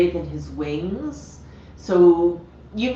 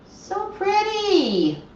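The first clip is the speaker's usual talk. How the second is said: high sing-song praise; 13 semitones higher, much slower than usual. Real, not acted. Owner praising her parrot in high slow baby talk. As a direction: coo it up high like praising a pet.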